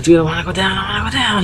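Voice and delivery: rising, pleading to get back down; retold scared plea. Scared on the lighthouse stairs, begging her friend: pleading. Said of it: "I was terrified to go up".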